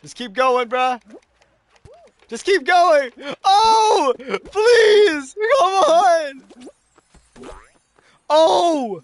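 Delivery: loud, high pleading scream with a crying edge; desperate screaming plea. Real, not acted. Streamer screaming at the game in a tight finish, crying plea. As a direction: beg the game to hold on, at full voice.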